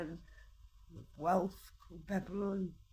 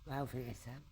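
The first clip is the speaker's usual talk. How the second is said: a hint of a lower, sneering voice for the people mocking her; mocking taunters; 9 semitones lower, 6 dB quieter than usual. Mimicking the people who taunt her, lower and mildly sneering.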